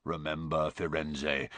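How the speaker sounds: quiet, menacing centaur warning; ominous low reminder; low growl. A narrator's reading. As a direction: growl it deep and soft. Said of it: "growled Bane".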